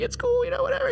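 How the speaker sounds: very high squeaky mock composure; choked-up, holding together. Preacher re-enacting keeping it together after the gift, comically choked up. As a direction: squeak it out as if trying not to cry. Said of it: "getting emotional at that point, trying to keep it together".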